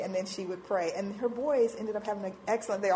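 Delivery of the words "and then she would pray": ordinary delivery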